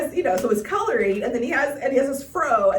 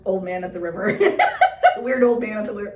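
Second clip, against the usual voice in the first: ordinary talk, nothing marked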